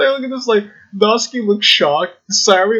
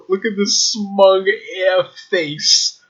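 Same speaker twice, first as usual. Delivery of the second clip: ordinary talk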